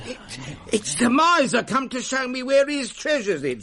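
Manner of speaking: excited thought. Acted.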